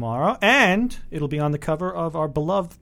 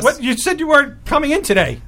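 higher, louder flustered protest; caught out; 10 semitones higher, 7 dB louder than usual. Acted. Caught host protesting to the returning boss, flustered and startled. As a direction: blurt it like you've been caught.

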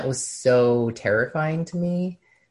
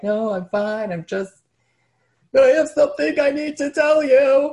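high, tearful, loud plea; crying; 14 semitones higher, 8 dB louder than usual. Retold or imitated. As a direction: voice cracking as she finally blurts it out. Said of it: "hysterical crying".

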